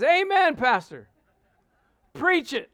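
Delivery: high, loud cheering on of the preacher; amening congregant. Retold or imitated. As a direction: shout hearty approval from the pew. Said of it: "she was amening at the wrong time".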